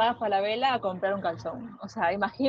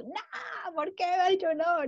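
mock tantrum; 8 semitones higher, 4 dB quieter, slower than usual. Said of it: "con los dramas pasa esto".

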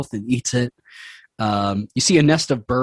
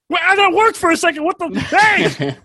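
very high startled little voice; squeaky frog; 3 dB louder than usual. Acted. The frog flustered when his head is pressed, squeaky. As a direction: squeak it out, taken aback.

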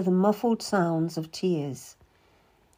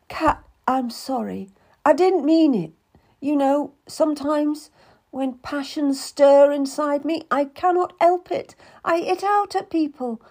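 higher, louder tearful lady's voice; pleading Anne; 8 semitones higher, 4 dB louder than usual. Acted. Anne apologizes to Cat, pleading and tearful. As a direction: beg forgiveness, voice rising.

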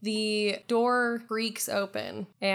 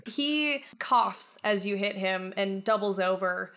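ordinary delivery

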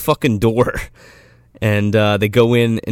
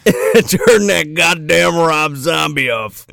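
slow, raised-pitch fake yell; mock complaint holler; 4 semitones higher, slower than usual. Joins in mock noise complaint.

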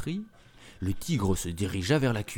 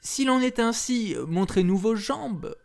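put-on higher wary child's voice; little girls' voice; 12 semitones higher than usual. A narrator's reading. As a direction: ask like suspicious little girls. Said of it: "demandèrent alors les enfants".

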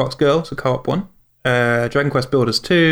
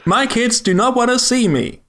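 high mock announcer; 9 semitones higher, slower than usual. Parody announcer lament.

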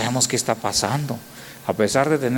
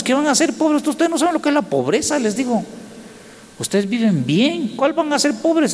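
incredulous high retort; 11 semitones higher than usual. Acted. Preacher scoffing at rich Europeans.

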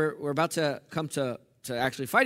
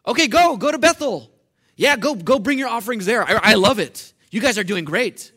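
much higher, louder mock cheer; mock-cheerful God, far higher and louder; sarcastic God; 4 semitones higher, 8 dB louder than usual. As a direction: fake enthusiasm hiding anger. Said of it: "God is angry".

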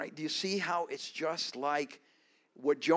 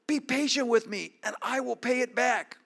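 pleading; 7 semitones higher than usual. Acted. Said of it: "begged him".

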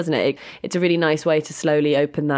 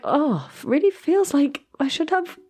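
wistful longing; 12 semitones higher than usual. Retold or imitated. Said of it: "I was like".

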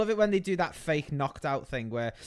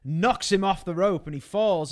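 ordinary talk, nothing marked

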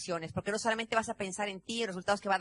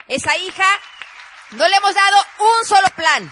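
emphatic shout; 8 semitones higher, 16 dB louder than usual. Real, not acted. Emphatic claim to audience.

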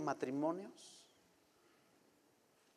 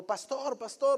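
higher, urgent calling of pastor; desperate plea; 8 semitones higher, 5 dB louder, slower than usual. Retold or imitated. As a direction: call out pastor, pitched up in panic. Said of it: "desesperado me dijo".

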